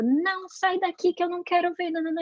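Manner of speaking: high-pitched mock telling-off; angry mother. Mother cross with the apologising child, mock angry. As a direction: shoo the child away in acted anger, pitched high. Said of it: "a mamãe ficou brava e falou".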